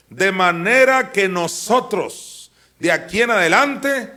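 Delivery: loud, slow, high pulpit reading; slow declaiming sermon voice; preaching at full force. Acted. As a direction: thunder the verse out to the congregation. Preacher reads scripture at full force.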